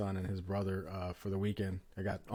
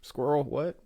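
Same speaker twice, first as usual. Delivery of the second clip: distracted kids; 6 semitones higher, slower than usual. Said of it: "they're just like".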